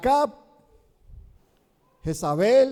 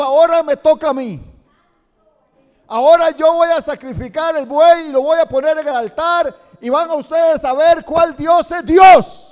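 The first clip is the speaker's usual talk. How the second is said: building from firm to loud, higher shout; rising proclamation; 4 semitones higher, 6 dB louder than usual. Acted. Elijah announcing his sacrifice to the crowd, building to a shout. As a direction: grow louder to a triumphant shout.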